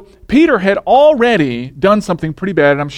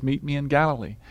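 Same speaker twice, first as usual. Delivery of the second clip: soft invitation; 9 dB quieter than usual. Acted. Jesus voiced, soft invitation.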